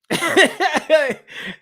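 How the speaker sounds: high burst of laughter; real laugh. Real, not acted. Commentator cracking up over the shrunken venue, high laughter.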